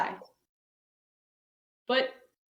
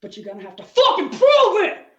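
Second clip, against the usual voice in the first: sudden loud, higher outburst; mock furious demand; 8 semitones higher, 8 dB louder than usual. The professor's email turning into a mock furious yell. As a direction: snap it out like an enraged professor. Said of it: "they'd be like".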